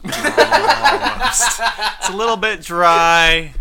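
laughing mockery. Mocking the almost-ready food.

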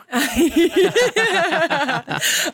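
bright rising laugh; a real amused laugh; light giggle after a joke. Real, not acted.

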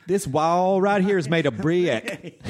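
slow high Mississippi drawl, brick stretched to two syllables; Mississippi accent. Retold or imitated. Stepmom from Mississippi, slow drawl stretching brick. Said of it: "So it'd be like".

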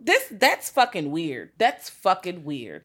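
slow, drawn-out disgust; slow, appalled. Real, not acted. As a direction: linger on how weird it is. Appalled at strange behavior.

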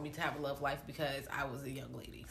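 whispering; a hushed whisper under breath; whispered aside. Real, not acted. Teasing aside about brother.

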